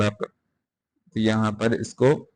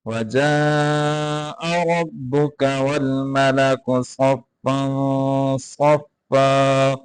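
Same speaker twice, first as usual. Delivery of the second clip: slow melodic tajweed chanting; Quran recitation; 3 semitones higher, much slower than usual. Acted. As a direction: draw each verse out in recitation.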